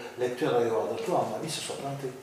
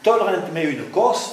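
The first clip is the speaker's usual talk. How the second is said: "Tolerant, mais une coste" higher and louder, two clear accents; demonstrating accent; 5 semitones higher, 7 dB louder than usual. Shown as a demonstration. Reciting the verse for colleagues, loud and accented. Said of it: "je relève le défi".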